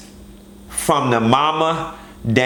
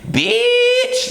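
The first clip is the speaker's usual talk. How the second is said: drawn-out, very high-pitched squeal; playful kiki squeal. Pretend. Joking around with the girls, high playful squeal. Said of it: "if we was kikiing".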